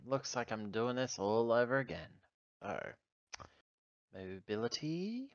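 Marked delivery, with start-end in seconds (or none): none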